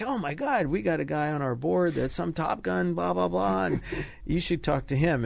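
high, gushing imitation of the director; breathless mock hype with a laugh; excited retelling. Excited director retold on phone.

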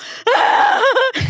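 loud, high fake bawling; mock hysterical crying. Woman crying too much for a man, mock wail. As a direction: bawl far too much, hammed-up.

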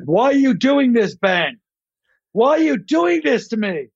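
high, pleading wife voice; distressed wife. Acted. Wife pleading with Ben in the sketch, high and wounded. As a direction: plead high, pitch arching up and falling away. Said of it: "his wife's like".

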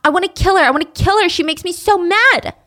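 loud, seething rage; raging. Retold or imitated. Wendy raging about her grandmother, loud fury. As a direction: spit it out in fury. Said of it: "Wendy's raging now".

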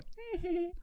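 high, quiet put-on giggle; demonstrated odd laugh. Shown as a demonstration. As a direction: show off a tiny high-pitched laugh. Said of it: "when I go".

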